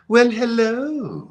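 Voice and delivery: high, slow, lilting camp greeting. Retold or imitated. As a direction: draw it out flirtatiously.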